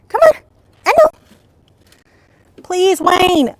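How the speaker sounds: high, loud coaxing calls; calling the dog. Real, not acted.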